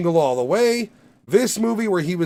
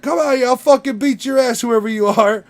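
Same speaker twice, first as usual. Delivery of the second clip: high, loud call to fight; mock challenge; 8 semitones higher, 6 dB louder than usual. McConaughey daring the hidden heckler to come out, mock threat. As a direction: bark a challenge into the alley. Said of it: "standing in the alleyway outside of the garage".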